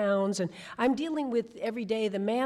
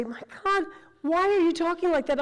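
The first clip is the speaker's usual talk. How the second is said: exasperated director; 8 semitones higher, 5 dB louder, faster than usual. Retold or imitated. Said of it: "the director would stop me and say".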